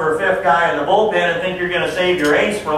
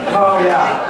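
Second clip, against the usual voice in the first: ordinary delivery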